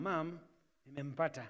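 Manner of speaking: acting a child carefully breaking news; shy announcement. Child telling mom about finding a partner, shy and soft.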